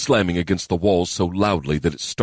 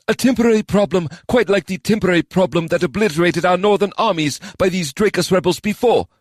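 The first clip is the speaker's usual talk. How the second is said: scornful, fast and louder; raised angry voice; 13 semitones higher, 4 dB louder than usual. Acted. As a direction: throw the words back angrily. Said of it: "his voice raised".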